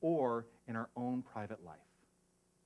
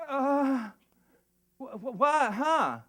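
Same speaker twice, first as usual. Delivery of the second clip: high, loud stammering confusion; mock bewildered follower; 13 semitones higher, 10 dB louder, much slower than usual. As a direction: play a lost, baffled seeker. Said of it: "people come to the spiritual leader to say".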